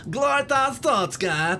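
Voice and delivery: high, loud mock Kazakh accent; hammed-up, high-pitched Borat accent. Shown as a demonstration. Gamer demonstrating the wrong accent, loud Borat accent. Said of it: "the sort of the Borat accent".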